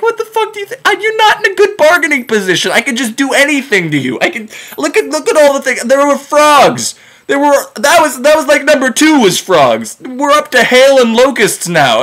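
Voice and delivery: high-pitched, hushed sputtering disbelief; incredulous protest. Acted. Prophet to stubborn ruler haggling amid plagues, incredulous protest. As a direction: argue in a high, exasperated voice. Moses incredulous at Pharaoh's bargaining.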